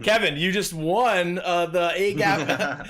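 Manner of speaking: slow, loud game-show announcer; drawn-out winner announcement like a host. Pretend.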